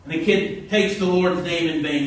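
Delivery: ordinary talk, nothing marked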